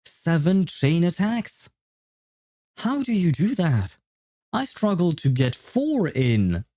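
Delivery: high, stretched-out incredulous questioning; mind-blown. Real, not acted. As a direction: marvel in baffled disbelief. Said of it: "that just broke my mind".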